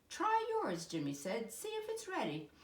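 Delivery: put-on high, eager little boy; high child's voice. A narrator's reading. Jimmy offering Dad the cooked fish, high and eager. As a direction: offer the fish like a proud kid.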